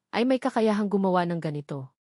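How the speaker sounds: ordinary delivery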